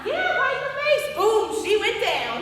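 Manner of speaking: loud, high, punchy retelling; big voice, slowing for the knockdown; excited boasting. Acted. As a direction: relive the punch with gusto.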